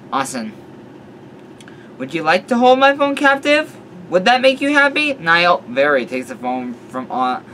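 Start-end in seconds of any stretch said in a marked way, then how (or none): 2.0-5.1 high, loud mock-sweet sarcasm; sarcastic. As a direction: offer it with fake sweetness. Sarcastic offer of phone.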